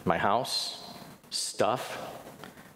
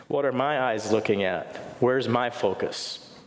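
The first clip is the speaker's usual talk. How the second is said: ordinary delivery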